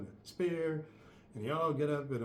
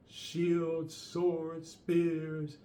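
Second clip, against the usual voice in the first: normal talking voice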